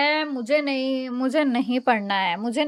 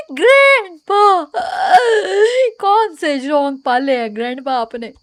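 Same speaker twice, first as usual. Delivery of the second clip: calling out; 9 dB louder than usual. Real, not acted. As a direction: yell for him across the field. Calling out to missing grandpa.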